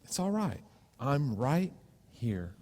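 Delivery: put-on calm a frightened child; soft reassurance. God's comforting voice, as before.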